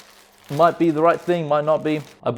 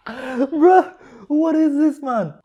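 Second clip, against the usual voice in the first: very high, loud, squealed protest; helpless disbelief; 13 semitones higher, 5 dB louder, much slower than usual. Real, not acted. Eater crying over the soup asks what it is, helpless squeal. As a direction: wail it in a squeaky, overwhelmed voice.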